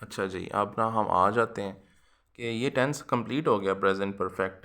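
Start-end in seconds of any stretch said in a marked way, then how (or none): none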